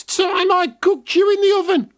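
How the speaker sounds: high, far wicked witch voice. Acted. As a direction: threaten like a gleeful witch. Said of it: "she cackled".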